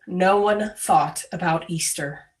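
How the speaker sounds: ordinary talk, nothing marked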